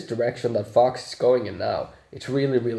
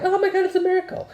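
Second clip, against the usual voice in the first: much higher and fast, gushing mock gasp; mock amazement; faster than usual. Mocking movie characters in a much higher, fast gasp. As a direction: squeal it like a starstruck fan. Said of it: "Everybody's just like".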